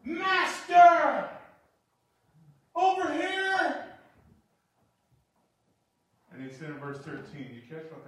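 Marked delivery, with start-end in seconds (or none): 0.0-3.9 high, loud desperate cry across a distance; calling out loud. Acted. Lepers shouting across to Jesus, desperate loud calling. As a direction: shout for his attention over the crowd.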